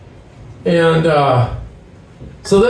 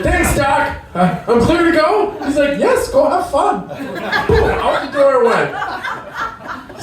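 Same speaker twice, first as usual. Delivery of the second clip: laughing; 8 semitones higher than usual. Retold or imitated. Retold exchange while laughing.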